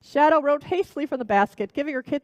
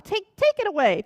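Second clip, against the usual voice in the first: higher, louder angry witch; angry command; 8 semitones higher, 4 dB louder than usual. Acted. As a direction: bark the order at the cat. Witch shouts, repeats it.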